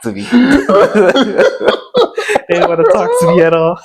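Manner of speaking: talking through real laughter; laughing. Real, not acted. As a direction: crack up while admitting it. Laughing over a painful memory.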